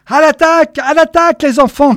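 high, loud rallying shout; battle cry. A narrator's reading. Boy captain fired by glory leads the charge, shouting. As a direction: cry out the charge as if leading an army. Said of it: "cria-t-il".

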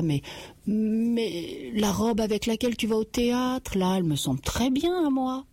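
mari qui bafouille, voix plus aiguë; hésiter sur le premier mot, puis filer; balbutiant. Acted. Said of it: "il balbutia". (le mari balbutie devant sa femme, voix un peu montée)

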